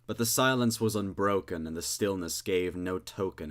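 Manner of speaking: ordinary delivery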